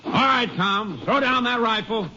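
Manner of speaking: high, firm order at a distance; commanding call. Acted. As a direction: make him drop the gun. Ranger orders armed man.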